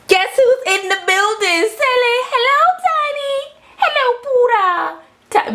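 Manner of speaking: squeaky pet-greeting voice; high pet-talk voice. Real, not acted.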